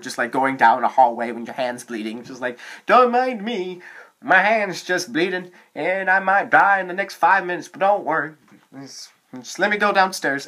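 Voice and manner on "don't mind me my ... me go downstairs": mock nonchalant. Mock calm bleeding kid voice.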